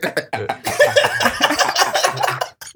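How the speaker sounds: high squawking cackle like a chicken; clucking laugh. Real, not acted. Friend's laugh turns into chicken clucks. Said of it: "Jesus Christ".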